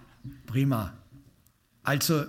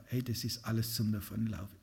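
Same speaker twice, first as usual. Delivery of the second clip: leise resigniert; 4 semitones lower, 7 dB quieter than usual. Retold or imitated. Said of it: "wir sagen".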